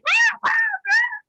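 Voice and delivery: high-pitched squealing laugh. Real, not acted. Laugh mid-narration.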